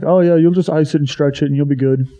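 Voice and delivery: lightly mock the careless old trainer. Pitcher mocking his college trainer's advice, dismissive.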